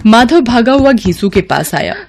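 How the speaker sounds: plain reading voice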